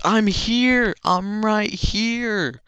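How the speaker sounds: high, stretched hero shout; eager hero answer. Retold or imitated. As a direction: call back high and drawn out, like a game hero. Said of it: "And he's like".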